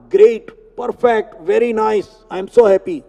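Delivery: usual speaking voice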